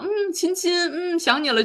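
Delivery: cutesy. Retold or imitated. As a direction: coo it like blowing kisses down the phone. Cutesy phone talk with mom. (对妈妈撒娇卖萌，声调偏高)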